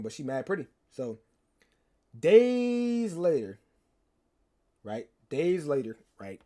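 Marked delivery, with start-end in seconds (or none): 2.2-3.6 high, loud, hugely stretched time-skip; dramatic drawn-out. Shown as a demonstration. Storyteller announcing a time jump to chat, dramatically drawn out. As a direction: stretch DAYS out like a title card.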